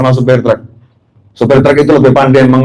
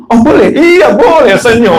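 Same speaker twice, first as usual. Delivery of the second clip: high, eager put-on reply; high-pitched acted obedience; chided angel complying; 10 semitones higher, slower than usual. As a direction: agree at once in a raised, comic voice. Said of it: "Ditegur sama malaikat".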